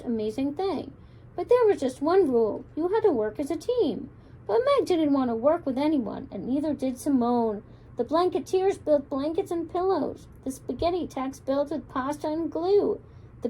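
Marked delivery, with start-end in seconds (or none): none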